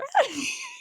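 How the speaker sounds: very high shrieking laugh; a shrieking giggle of delight; giddy squeal laugh. Real, not acted.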